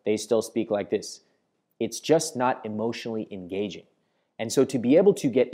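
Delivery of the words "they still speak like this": flat monotone. Shown as a demonstration. Coach imitating dull speakers' big news, flat monotone. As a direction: drone on with no pitch movement. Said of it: "remain in the same flat monotone".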